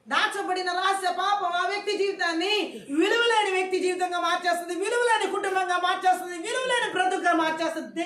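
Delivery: loud, high-pitched sermon cry; impassioned preacher at full volume; full-force preaching. Real, not acted. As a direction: drive each clause home to the congregation.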